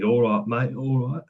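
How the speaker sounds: light Cockney "all right, mate"; a touch of London Cockney, casual and friendly; Cockney greeting. Retold or imitated. Imitating an English coworker from London with a light Cockney accent. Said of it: "real, uh, Cockney".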